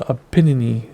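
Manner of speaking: "Apennine" said uh-PEN-uh-nee; Apennine ending in "nee". Shown as a demonstration. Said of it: "also called the Apennine".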